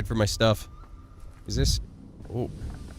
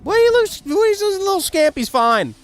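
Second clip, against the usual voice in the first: voiced character; 6 dB louder than usual. Voicing a character in game.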